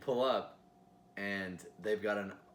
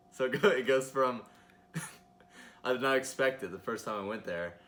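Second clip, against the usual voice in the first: ordinary talk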